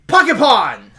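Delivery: high, loud exclamation; stunt battle cry. Acted. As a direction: shout it as you launch the move. Character calls out a stunt cry.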